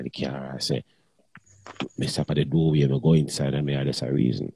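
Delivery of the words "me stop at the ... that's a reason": ordinary delivery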